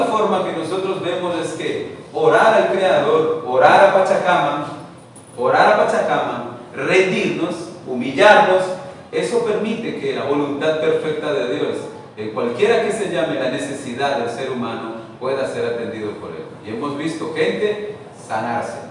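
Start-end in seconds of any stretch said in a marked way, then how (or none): none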